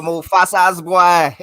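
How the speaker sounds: put-on loud, drawn-out Jamaican accent; mock Jamaican accent. Co-host imitating Rafiki from Lion King, Jamaican accent. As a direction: call out in a hammed-up Jamaican accent. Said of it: "Rafiki is Jamaican".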